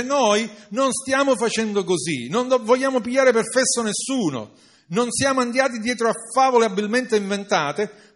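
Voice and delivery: fervent sermon quote; emphatic preaching. Acted. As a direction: drive the apostle's claim home.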